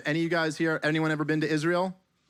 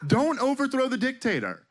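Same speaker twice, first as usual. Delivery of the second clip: high, pleading warning against overthrowing the dictator; pleading advice; 8 semitones higher than usual. Retold or imitated. Comic to the government, high pleading warning. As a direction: beg them to leave the dictator alone, pitched well up. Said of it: "you're just like".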